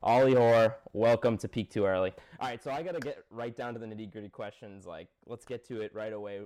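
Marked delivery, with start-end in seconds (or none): 0.0-2.1 loud show intro. Acted. Host opens the podcast.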